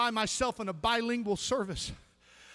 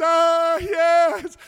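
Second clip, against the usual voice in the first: eager prisoner's yes; 5 semitones higher, 9 dB louder than usual. Retold or imitated.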